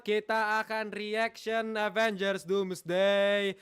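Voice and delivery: slow, drawn-out announcer build-up; hype intro. Acted. Streamer announcing the Avengers reaction to viewers, slow hype. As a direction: stretch every word like a show host.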